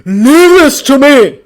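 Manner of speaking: loud slow commanding shout. A narrator's reading. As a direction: shout it over the chaos. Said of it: "Thor shouted".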